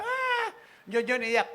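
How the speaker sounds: high mock wail then urging; hammed-up crying cue. Wife prompting crying.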